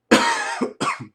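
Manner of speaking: loud sick cough. Real, not acted. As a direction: cough hard, you're ill. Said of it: "Pardon the coughing".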